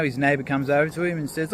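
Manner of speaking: normal talking voice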